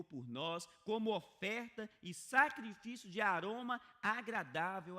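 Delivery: loud, slow, driving sermon delivery; forceful preaching. Acted. Pastor urging the congregation, loud and slow. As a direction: preach it out to the whole church.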